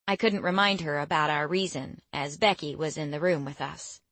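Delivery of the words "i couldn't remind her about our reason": ordinary delivery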